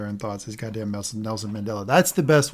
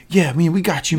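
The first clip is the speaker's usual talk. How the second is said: louder, upbeat team encouragement; pep-talk cheer; 4 semitones higher, 4 dB louder than usual. Retold or imitated. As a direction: hype up a nervous teammate. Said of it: "Sam is like".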